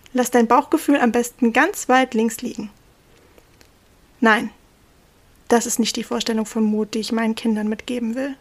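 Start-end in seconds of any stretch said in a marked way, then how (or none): none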